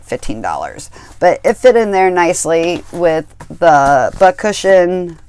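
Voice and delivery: normal talking voice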